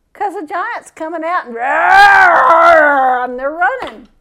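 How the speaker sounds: drawn-out hammed-up cry, loud; mock panicked wail. Preacher mimics Israel's army fleeing Goliath, in a loud mock wail. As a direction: howl it like terrified soldiers running.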